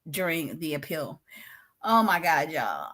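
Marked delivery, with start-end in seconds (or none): 0.1-1.2 hushed, breathy aside; whisper. Real, not acted. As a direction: lean in and whisper it. Secret aside to viewers.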